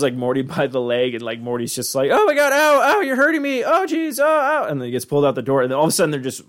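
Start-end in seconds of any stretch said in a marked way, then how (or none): 2.1-4.6 very high, whiny yelps of pain; squealing teen getting hurt. Retold or imitated. Morty grabbed, cries out.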